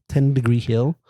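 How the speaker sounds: usual speaking voice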